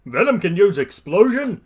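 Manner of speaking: high, loud puzzled questions; startled realisation. A narrator's reading. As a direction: wonder aloud in astonishment. All Might's startled thought.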